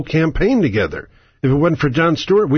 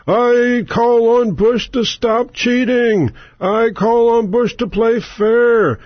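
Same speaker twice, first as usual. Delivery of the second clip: high, slow pleading whimper; mock Kerry begging Bush like a meek child; a pitiful, hammed-up plea pitched way up; whiny Kerry; 7 semitones higher, slower than usual. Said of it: "Kerry can go up there and say".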